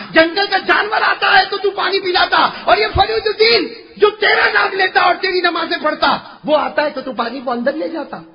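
high, shouted plea in preaching voice; impassioned complaint. Acted. Saint protesting that animals get water, impassioned outcry. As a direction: cry out the grievance to God.

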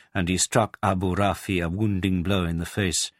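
usual speaking voice

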